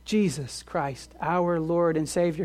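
usual speaking voice

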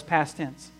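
normal talking voice

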